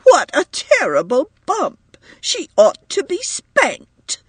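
high, slow, indignant outburst; a mother furious at the other child. A narrator's reading. Upset mother blames the girl.

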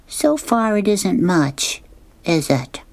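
put-on a little girl sheepishly admitting. Sally admits report is short.

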